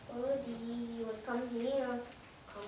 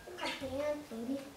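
ordinary talk

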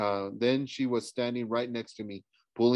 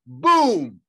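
loud, drawn-out blast word; explosive boom; 5 semitones higher, 10 dB louder than usual. Acted. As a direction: burst out with it like an explosion. Narrator imitates the car exploding.

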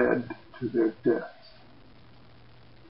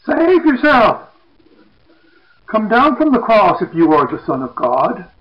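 taunting shout; 9 semitones higher, 9 dB louder than usual. Retold or imitated. Crowd jeering at Jesus on the cross, loud mocking shout. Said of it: "They shout at him".

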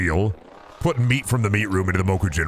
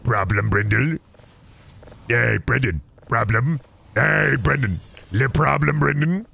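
mock troll-face taunt, rising higher; 3 semitones higher, slower than usual. Game's secret trolling the streamer, taunting put-on voice climbing higher. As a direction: needle him in a put-on troll voice. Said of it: "you fucking troll-faced at me".

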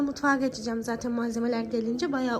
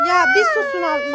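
real yell, much higher and louder; exasperated shout; 13 semitones higher, 8 dB louder than usual. Real, not acted. Mother snaps at noisy kids.

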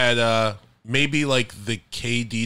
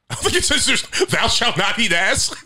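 laughing; 12 semitones higher than usual. Pretend. Mock biblical quote, laughing.

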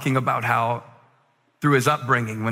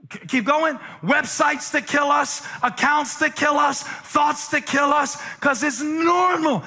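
preaching at full force; 11 semitones higher than usual. Acted. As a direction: whoop it up like a revival preacher.

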